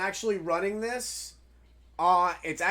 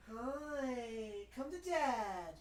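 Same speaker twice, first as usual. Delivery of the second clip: very soft, high pet talk; tender cooing; 3 semitones higher, 15 dB quieter, slower than usual. Real, not acted. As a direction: coo to the pet lovingly.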